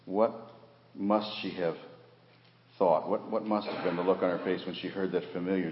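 usual speaking voice

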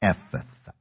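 slow, soft Ephphatha, drawn out syllable by syllable; gently mouthing "Ephphatha" to a deaf man; very slowly. Retold or imitated. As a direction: speak "Ephphatha" softly and deliberately for lip reading. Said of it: "speaking that word very, very slowly".

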